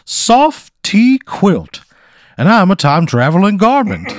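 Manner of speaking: high, loud blustery cartoon rooster voice; Foghorn Leghorn. Scene: the time-travelling quilt introduces itself to Pat, in a blustery cartoon rooster voice. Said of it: "he sounds like a, like, Foghorn Leghorn almost".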